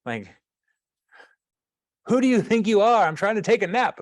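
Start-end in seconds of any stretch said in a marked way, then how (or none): 2.1-4.0 high, loud indignant grandmother; scolding grandma. Acted. Old woman calling out to the general, scolding like a grandma. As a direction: call out like an annoyed grandma, pitched way up. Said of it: "only grandmas can do".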